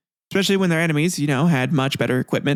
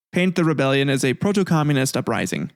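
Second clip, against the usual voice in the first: ordinary talk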